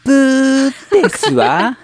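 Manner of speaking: shrill-free high, loud ojou-sama buzzer; haughty rich girl gloating wrong answer; Kurosawa Dia. Acted. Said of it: "そのキャラ何なんですか".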